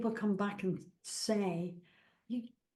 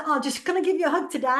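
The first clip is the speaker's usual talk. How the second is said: much higher, louder and fast, bubbly; to a stranger in the shopping centre, bright excited offer; cheery announcement; 7 semitones higher, 9 dB louder, much faster than usual. Retold or imitated. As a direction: burst out happily as you go in for the hug. Telling strangers she'll hug them.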